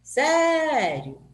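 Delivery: "Sério" with a long, high, loud É sliding down; stressed vowel demo. Shown as a demonstration. Teacher asking which vowel is stronger, exaggerated stress on "Sério". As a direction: stretch the first vowel of "Sério" high, let "io" fall. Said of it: "pronunciei quem mais?".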